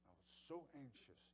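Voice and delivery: usual speaking voice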